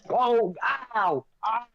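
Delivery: sudden high cry of pain; startled yelp. Real, not acted. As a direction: yelp as hot water spills. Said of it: "I spilled hot water on myself".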